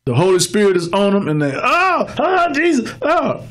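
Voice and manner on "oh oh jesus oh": high-pitched cries, no added volume; mock protest shout. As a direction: imitate a worshipper crying out under the Spirit. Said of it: "Sometimes it's a protest shout".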